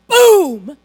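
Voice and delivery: sudden loud boom. Acted. Preacher describing fire from heaven, loud boom. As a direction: hit it hard like an explosion.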